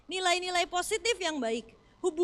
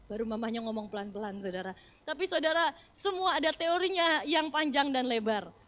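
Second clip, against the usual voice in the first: ordinary delivery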